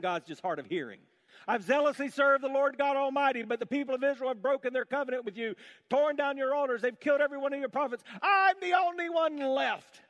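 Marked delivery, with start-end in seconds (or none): 1.5-10.0 high, loud whining, mocking Elijah; self-pitying whine. Preacher mocks Elijah repeating his complaint, in a high whine. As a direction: ham up the sulking complaint.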